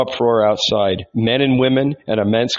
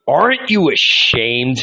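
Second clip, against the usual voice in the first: shouted; 3 semitones higher than usual. A narrator's reading. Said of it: "he shouted".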